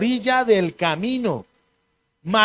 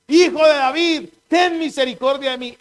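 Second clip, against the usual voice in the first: higher, louder cry for mercy; 6 semitones higher, 5 dB louder than usual. Retold or imitated. Blind Bartimeo crying out to Jesus for mercy, loud and higher. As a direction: call out to Jesus from the roadside. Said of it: "Empezó a decir".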